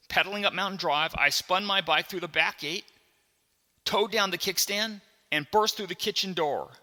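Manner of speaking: high, loud, driving storytelling; urgent narration. A narrator's reading. Boy racing home on his bike, told with high, loud urgency. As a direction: build the rush of a race home.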